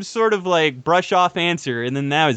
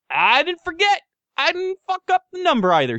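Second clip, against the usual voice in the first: high, bright self-praise; proud excited; 10 semitones higher than usual. Real, not acted. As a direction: cheer yourself on for remembering.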